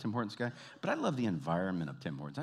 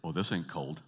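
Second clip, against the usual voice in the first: lower-pitched, unimpressed mock Canadian; imitated smug dismissal, pitched down; nonchalant Canadian boast; 4 semitones lower than usual. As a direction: brush off the cold with relish. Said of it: "get this thrill up their leg to say".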